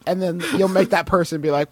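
ordinary talk, nothing marked

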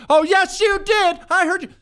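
very high, loud mock shriek; loud angry girlfriend. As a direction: cut him off with a hammed-up accusing screech. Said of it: "she starts to get loud".

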